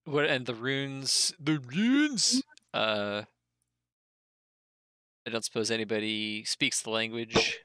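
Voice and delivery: ordinary talk, nothing marked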